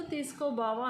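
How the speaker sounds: ordinary delivery, nothing marked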